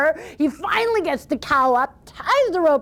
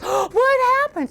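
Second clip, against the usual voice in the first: high, slow mock alarm; a father bursting in on chaos; 11 semitones higher, slower than usual. Father finds them crying.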